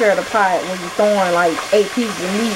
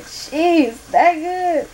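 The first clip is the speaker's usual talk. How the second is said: mock disbelief; 9 semitones higher, much slower than usual. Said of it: "I'm like".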